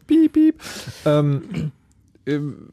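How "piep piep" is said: high squeaky beep sounds through laughter; laughing censor beep. Pretend. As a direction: bleep it out like a censor. Bleeping out brand names.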